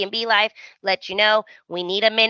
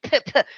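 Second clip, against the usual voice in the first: spitting sounds; 5 semitones higher than usual. Retold or imitated. Spitting out sand.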